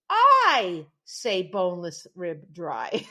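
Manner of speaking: slow, loud echo of his broken English; defiant comeback. Retold or imitated. Gina standing up to the man, slow defiant echo. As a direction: throw his words right back at him. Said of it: "she stood right up to him and she said".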